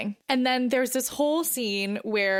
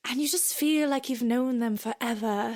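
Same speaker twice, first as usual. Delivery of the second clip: put-on British accent; 3 semitones higher than usual. Quoting Rachel's speech in a mock British accent. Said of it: "our British accents".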